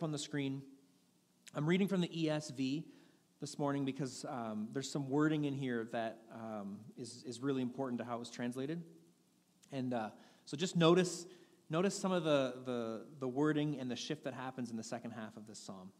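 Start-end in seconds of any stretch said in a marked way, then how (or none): none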